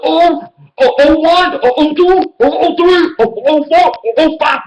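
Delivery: high, loud mock counting of losses. Taunting the Bears fan by counting losses in a high mock voice. Mocking the rival's losing record.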